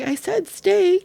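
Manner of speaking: put-on high, slow child's voice; drawn-out, high-pitched insistence; insistent child. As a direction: spell it out like an insistent kid. Daughter's insistent answer retold.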